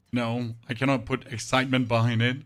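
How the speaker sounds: usual speaking voice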